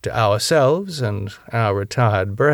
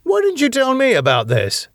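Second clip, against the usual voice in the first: high, indignant demand, a bit louder; angry; 3 dB louder than usual. Acted. Valentine confronts Claire over the call, indignant. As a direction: call her out for hiding it.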